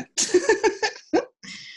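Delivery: bright burst of laughter; high laugh. Real, not acted. Laughs after accent remark.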